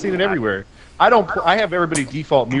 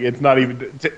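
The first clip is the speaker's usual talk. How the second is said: normal talking voice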